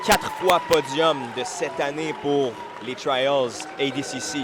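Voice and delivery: loud, drawn-out hype announcer; brash fight promoter hype. Acted. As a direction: sell the fighter to the crowd. Hyping fighter record to crowd.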